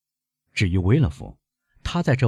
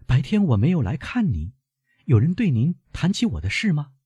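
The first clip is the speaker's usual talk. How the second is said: put-on high-pitched young woman questioning her grandfather; concerned girl, pitched well up; worried granddaughter; 9 semitones higher than usual. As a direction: lift the voice high, anxious questions.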